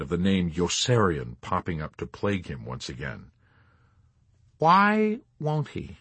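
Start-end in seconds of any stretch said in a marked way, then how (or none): 4.6-6.0 high, drawn-out question; stretched, high incredulous query; plaintive question. A narrator's reading. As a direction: ask it in slow, high-pitched bafflement. Colonel cries out in his brooding.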